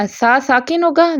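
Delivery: ordinary talk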